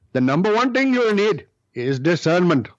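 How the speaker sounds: put-on Indian-accented guru voice. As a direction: imitate the Indian teacher's accent. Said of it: "That's why I do the accent".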